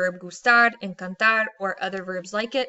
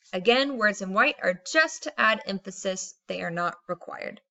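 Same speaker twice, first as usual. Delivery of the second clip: ordinary talk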